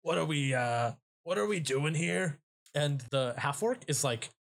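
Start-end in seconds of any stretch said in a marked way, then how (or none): 0.0-2.4 a lightly raised, confused stone head; puzzled little voice, a bit higher; Broggedy voice. Acted. The stone head asks where he is, in a slightly higher, confused voice.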